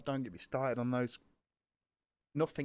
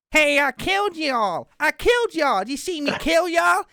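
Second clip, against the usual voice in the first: put-on loud, high, slow American gamer drawl in a teen's voice; American accent; 14 semitones higher, 13 dB louder, slower than usual. Kid on voice chat taunting American players, loud American accent. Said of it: "I picked up an American accent".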